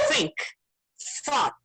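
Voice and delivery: "think" said as fink, "thought" as fought; Cockney th as f. Shown as a demonstration. Learner showing how Cockney speakers say th, f-sound demo. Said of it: "they pronounce it as F".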